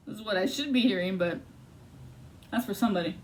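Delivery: ordinary delivery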